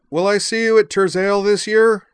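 loud, high, sustained holler; yelled question. A narrator's reading. Azalin asks Zoner from halfway across the hall front, yelling. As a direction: call the question across the distance. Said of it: "turned around and yelled".

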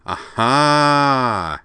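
loud, high, drawn-out triumphant cry. A narrator's reading. Derek spotting someone his friend can't know, triumphantly. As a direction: crow it in triumph, stretched out. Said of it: "he said triumphantly".

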